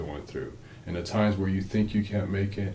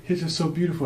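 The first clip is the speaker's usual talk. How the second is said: much higher, tearful, overwhelmed; choked-up gush; 10 semitones higher, 4 dB louder, faster than usual. Retold or imitated. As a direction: gush like you're about to cry. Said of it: "I'd be like".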